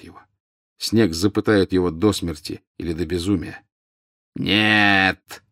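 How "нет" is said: long drawn-out cry, only moderately raised; Snape's roar. A narrator's reading. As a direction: stretch the word into a restrained roar. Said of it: "взревел голос Снега". (протяжный сдержанный рёв)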